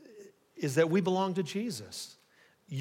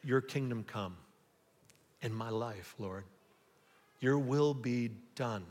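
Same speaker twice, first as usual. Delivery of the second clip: softer, lower praying voice; quiet prayer; 4 semitones lower, 7 dB quieter than usual. Acted. Preacher praying to the Lord for his own life, quietly. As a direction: pray it softly, with pauses between phrases.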